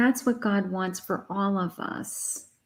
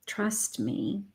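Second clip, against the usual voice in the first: much softer, a gentle plea; soft and tender as Spirit reassures; soft reassurance; 8 dB quieter than usual. Acted. Spirit gently urging the listener, soft and close. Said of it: "Spirit is saying".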